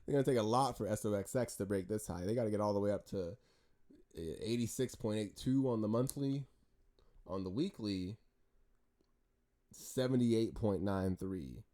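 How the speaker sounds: normal talking voice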